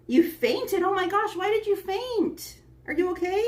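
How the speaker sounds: high, startled and worried; surprised concern. Real, not acted. Streamer reacting to viewer who fainted, high alarmed concern. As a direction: gasp it out, genuinely alarmed.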